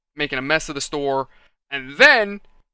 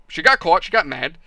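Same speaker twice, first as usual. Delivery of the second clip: ordinary delivery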